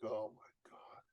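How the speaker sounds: slow, unvoiced shocked whisper. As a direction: breathe it out in dismay. Retold shock at the fee.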